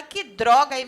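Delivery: loud, retold teenage outburst; exclamação de desabafo. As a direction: blurt it out as a mock curse. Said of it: "depende da entonação da droga". (desabafo encenado, em voz alta)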